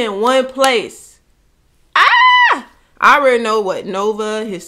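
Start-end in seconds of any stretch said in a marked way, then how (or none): 2.0-2.7 a sharp, very high exclaimed "Ah!"; sudden high cry. Real, not acted. Reacting to the video with a sudden high cry. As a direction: yelp out in surprise.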